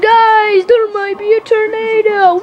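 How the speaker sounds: high, loud wailing alarm; panicked shout. Acted. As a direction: burst in crying out a frantic warning. Sonic bursts in with warning.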